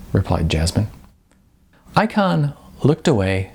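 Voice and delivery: ordinary delivery, nothing marked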